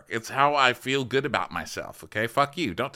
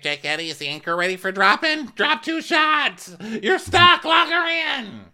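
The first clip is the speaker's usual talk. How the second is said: high, loud anchor commands; imitating the captain's anchor orders, high and loud; mock radio orders; 10 semitones higher, 5 dB louder than usual.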